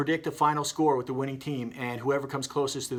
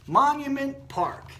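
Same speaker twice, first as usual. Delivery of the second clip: triumphant reveal; 12 semitones higher, 5 dB louder, slower than usual. Acted. Hot dog revealing the answer.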